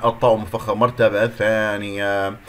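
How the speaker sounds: normal talking voice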